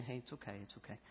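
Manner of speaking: very soft, low reassurance; soothing. Retold or imitated. Husband rubbing crying wife's back, hushed and soothing. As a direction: murmur it gently, fading almost to nothing. Retold comforting of crying wife.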